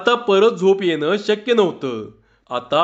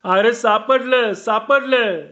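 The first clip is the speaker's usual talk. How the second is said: higher, drawn-out cry of finding it; shout of discovery; 6 semitones higher, slower than usual. Acted. Sadavani calling to the searchers that he found it, higher excited cry. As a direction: call out the find, a touch of a shout. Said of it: "सदावानी ओरडला".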